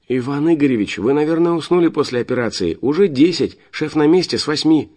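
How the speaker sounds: put-on high, chirpy girl voice; bubbly princess chirp; cartoon princess. A narrator's reading. Alina voiced on phone.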